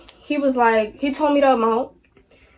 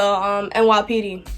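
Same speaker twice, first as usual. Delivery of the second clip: ordinary talk, nothing marked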